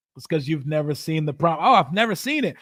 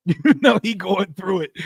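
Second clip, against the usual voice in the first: laughing; 3 dB louder than usual. Real, not acted. Laughing at a struggling rival.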